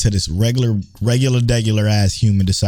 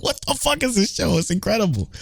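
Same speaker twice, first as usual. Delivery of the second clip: amazed exclaim; 10 semitones higher, 5 dB quieter than usual. Retold or imitated. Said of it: "I'm like".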